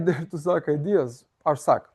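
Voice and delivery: ordinary talk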